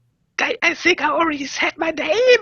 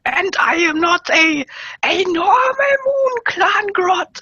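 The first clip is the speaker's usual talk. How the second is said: put-on Bavarian accent; slower than usual. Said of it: "in a Bavarian accent".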